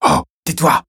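exasperated command. A narrator's reading. Gendarme to a screaming suspect, exasperated. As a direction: cut in to silence him.

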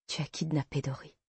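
soft, stunned murmur. A narrator's reading. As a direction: barely voice the accusation. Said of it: "murmurai-je". (murmuré, sous le choc)

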